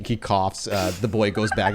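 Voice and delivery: ordinary talk, nothing marked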